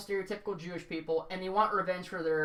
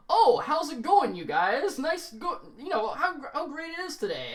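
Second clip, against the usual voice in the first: higher, louder, chirpy neighbourly greeting; friendly dad voice; 6 semitones higher, 4 dB louder than usual. Retold or imitated. Mimicking the villain's fake friendly dad greeting to the viewers, higher and brighter. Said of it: "He acts like that character".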